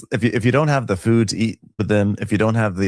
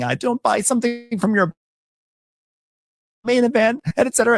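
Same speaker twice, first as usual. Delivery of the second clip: high, mocking falsetto impression; squeaky mock of the accuser. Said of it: "Then you come for me saying".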